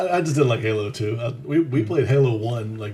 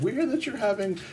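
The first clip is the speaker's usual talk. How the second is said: high, loud, mocking elder voice; stern church elder; 11 semitones higher, 6 dB louder, slower than usual. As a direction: play the disapproving elders, laughing.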